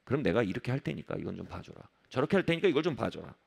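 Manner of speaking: acted coaxing, voice dropped. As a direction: plead for leniency in an undertone. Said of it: "마지막 방법은 이제 회유합니다".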